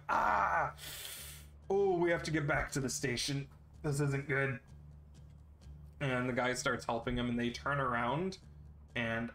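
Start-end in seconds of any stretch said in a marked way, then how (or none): 0.1-4.6 pained guard groan. Acted. GM voices wounded guard.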